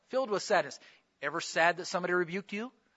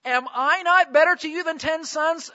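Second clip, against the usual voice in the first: higher, puzzled husband voice; clueless husband; 7 semitones higher, 3 dB louder than usual. Retold or imitated. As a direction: play the baffled husband who doesn't get it. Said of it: "He said to her".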